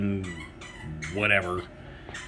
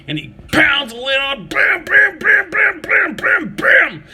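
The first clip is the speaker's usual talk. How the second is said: rising to a much higher, much louder shouted bam; escalating yelled bam bam bam; building shout; 10 dB louder, slower than usual. Real, not acted. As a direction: pound out each bam harder and louder. Ranting about the lid pounding.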